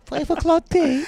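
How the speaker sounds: put-on high, slow genteel old lady voice; prim old person voice. Mocking old folks' habits, in a high slow genteel voice. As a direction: draw out each word like a prim old granny.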